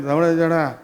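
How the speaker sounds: flat, soft, displeased; sour. Shown as a demonstration. Elder son hearing the news, sour and deflated. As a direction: let the face fall as you say it. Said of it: "మొహం ఎంత మెసకపోయింది".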